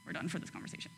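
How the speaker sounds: mock hurt God fading to a whisper; fading mock sulk. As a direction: trail off into a sulky whisper. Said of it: "he's like".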